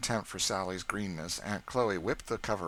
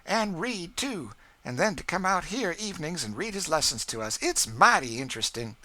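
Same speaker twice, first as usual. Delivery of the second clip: Aunt Chloe voice; 12 semitones higher, 6 dB louder than usual. A narrator's reading.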